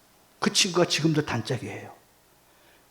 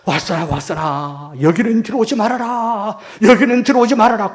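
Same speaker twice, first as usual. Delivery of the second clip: father's warning voice; 5 semitones higher, 5 dB louder than usual. Retold or imitated. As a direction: call out a long, insistent warning as the father. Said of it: "우리 아버님이 음성이 들려오는 거예요". (길게 뽑으며 점점 높고 크게 경고하는 아버지 목소리)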